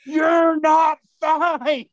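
high, slow, loud panicked yell; mock scream. Dog owner yelling at the injured man, high and panicked. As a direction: shout it at him in a panic. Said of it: "I remember him screaming at me".

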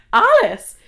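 loud, high cry of disbelief, falling at the end; outraged repeat. Retold or imitated. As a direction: throw the name out in outrage. Repeats the wrong name in outrage.

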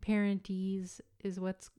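normal talking voice

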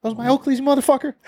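high, indignant call-out at the thief; mock confrontation. Friend imagines catching a coworker in his sunglasses, high mock outrage.

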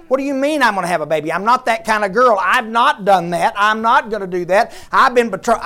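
indignant, loud, fast protest; sassy indignant Mary, loud and quick; attitude. Retold or imitated. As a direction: huff at the angel like a girl insulted. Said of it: "she has an attitude with him".

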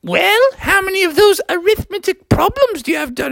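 very high teacher's question. Acted. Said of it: "she was like".

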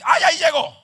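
put-on high, loud cry of the startled old woman; frightened cry. Old woman crying out when the light goes off, loud fright. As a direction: shriek as the shadow comes back.

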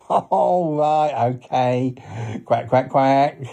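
cartoon duck voice with a laugh; chuckle, then quack along; talking duck character; chuckling duck. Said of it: "he is a duck".

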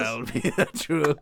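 lightly put-on, odd captain's accent; a hint of the captain's accent; Captain Hook. Defending the Hook impression, in the put-on accent. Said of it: "This is how he speaks".

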